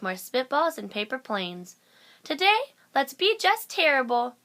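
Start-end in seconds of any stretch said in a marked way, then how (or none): 2.2-4.4 mischievous glee. A narrator's reading. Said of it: "they said".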